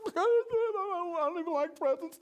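put-on high, loud little boy voice, laughing; a child pretending not to mind; child brave through tears. Boy telling mom he doesn't like presents, high child voice. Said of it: "I'm crying".

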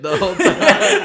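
high, loud laughing talk; cracking up over the headsets. Real, not acted. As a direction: giggle through it. Laughing about headset jokes.

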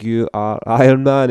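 slow, loud announcer shout. Retold or imitated. Finish-line announcer shouting his name over the line, slow and loud. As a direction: bellow the finisher's title. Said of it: "the guy shouts".

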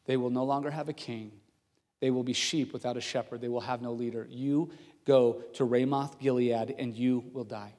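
low, soft pronouncement of doom; grave, sincere prophecy. Acted. Prophet tells the king he will die, grave and hushed. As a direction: deliver the warning quietly and solemnly. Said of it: "his tone change and the sarcasm completely leave".

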